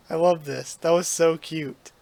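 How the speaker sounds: normal talking voice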